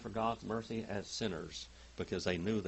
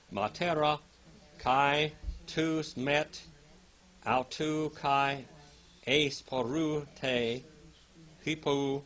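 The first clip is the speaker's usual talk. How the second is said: scripture reading; 4 semitones higher, 3 dB louder, slower than usual. Acted. Reads Greek verse aloud.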